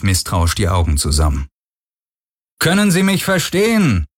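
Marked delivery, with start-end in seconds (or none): 2.6-4.1 high, projected announcement across the water; raised, far-carrying voice; calling over loudspeaker. A narrator's reading. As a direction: call out as if through a loudspeaker. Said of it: "sagte eine männliche Stimme über Lautsprecher".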